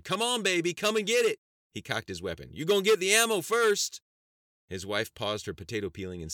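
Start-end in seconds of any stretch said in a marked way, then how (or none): 0.0-1.4 very high, full-out yell; shouted. A narrator's reading. Farmer with a shotgun daring all comers, shouting. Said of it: "and shouted". 2.5-4.0 very high, loud holler; shouted threat. Acted. Farmer cocking his shotgun at imagined comers, shouting.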